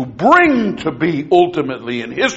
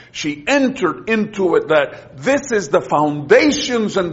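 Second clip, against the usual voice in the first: higher, building preacher's fervour; rising preaching; 7 semitones higher than usual. Real, not acted. Preacher stirring the class about Hannah, climbing in fervour. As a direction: climb steadily as the sermon lifts.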